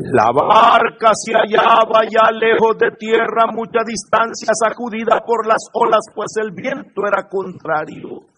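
high chant-like proclamation; scripture reading. A narrator's reading. Preacher telling of the storm-tossed boat, proclaimed reading. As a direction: hold a high, even reciting pitch. Reads the Gospel aloud.

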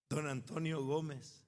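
usual speaking voice

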